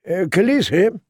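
cautious, uncertain appeal from a shamed knight; hesitant knight. Acted. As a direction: tentatively ask the queen for a hearing. Disgraced knight hesitantly addresses queen.

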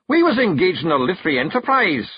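high, lightly voiced Scottish pictsie; a touch of gruff Feegle pride; Feegle Rob.

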